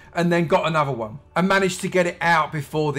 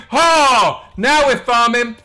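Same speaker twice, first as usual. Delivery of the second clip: loud, high burst of triumph; triumphant shout; 7 semitones higher, 10 dB louder, much slower than usual. Real, not acted. Gamer getting the villager in the boat, triumphant shout. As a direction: crow it out, loud and drawn out.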